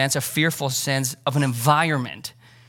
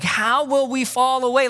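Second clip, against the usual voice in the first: higher, puzzled disciple voice; a hint of a confused disciple; imagined disciple question; 9 semitones higher than usual. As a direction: ask it like a baffled follower. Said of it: "they don't even ask".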